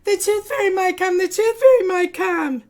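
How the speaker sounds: high, loud triumphant chant; bursting with excitement; child shouts to mom she lost her tooth, high and loud; gleeful shouting. Acted. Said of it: "screamed Elda".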